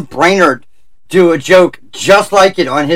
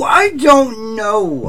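long drawn-out, high cry of disbelief; exasperated wail; 7 semitones higher, much slower than usual. Real, not acted. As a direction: wail it out, stretching each word very slowly. Exasperated cry over absurd news.

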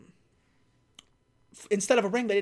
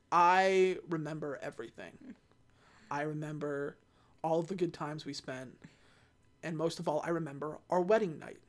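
softer, lower, tender confession; soft tender plea; 4 semitones lower, 7 dB quieter than usual. Retold or imitated. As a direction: murmur it gently over a dying husband. Letty cradles dying Dom.

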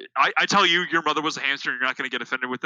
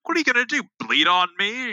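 mock taunt; 7 semitones higher than usual.

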